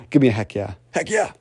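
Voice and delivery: ordinary talk, nothing marked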